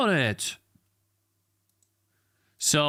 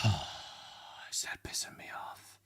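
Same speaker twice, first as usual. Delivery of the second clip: fed-up whisper; 7 semitones lower, 8 dB quieter than usual. Real, not acted. Fed-up streamer whispering while the upload stalls. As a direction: mutter it close to breaking point.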